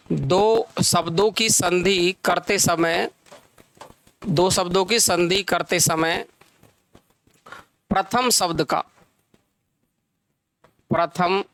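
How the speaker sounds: measured teacher dictation with long pauses; slow dictation. Real, not acted. Teacher giving students the definition of sandhi, slow dictation. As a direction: dictate phrase by phrase, leaving time to write.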